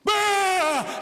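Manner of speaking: loud, high startled yell; sudden mock scream. Screaming heard suddenly out of the dark, mock yell. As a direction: shatter the hush with a yell. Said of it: "I could hear this screaming and shouting".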